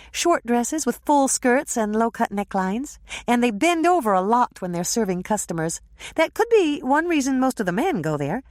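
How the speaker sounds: dish the gossip; lightly voiced young woman. Andrea describing waitresses.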